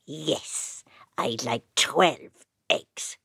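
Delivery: very low, slow creature voice; drawn-out low creature. Acted. Changeling creature voice.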